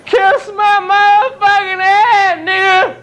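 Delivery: loud, crying yell at the world; tearful angry outburst. Acted. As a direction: break into a sobbing shout.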